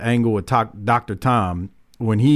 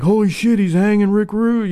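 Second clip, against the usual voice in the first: put-on excited kid; 8 semitones higher than usual. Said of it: "you're like".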